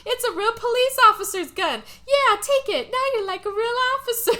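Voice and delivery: hammed-up high, loud, eager coaxing; mock police officer. Officer to distraught George, loud high mock encouragement. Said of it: "the police officer is".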